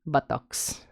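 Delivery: "buttocks" in a Tagalog accent, "BOO-tawks"; lightly Tagalog-accented "buttocks". Shown as a demonstration. Host demoing "buttocks" in a Tagalog accent. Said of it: "if I had to say in the Tagalog accent".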